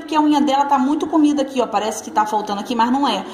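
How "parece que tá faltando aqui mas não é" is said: ordinary delivery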